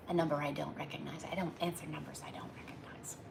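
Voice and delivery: soft whispered aside to herself; real whisper about an unknown caller on the phone. Real, not acted. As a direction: breathe it under your breath, not for the audience.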